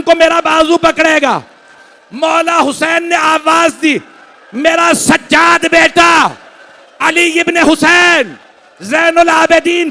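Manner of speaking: high, loud, slowed keening shout; mourning shout, drawn out and high; wailing lament. Acted. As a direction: cry out the call for help in grief. Full-force mourning recitation, shouted.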